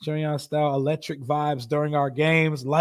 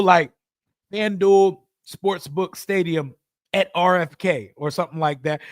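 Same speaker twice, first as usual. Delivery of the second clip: ordinary talk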